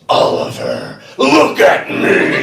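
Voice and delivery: booming stern teacher; teacher's scary voice at a kid. Shown as a demonstration. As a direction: snap his attention with a scary voice. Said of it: "in my scary voice".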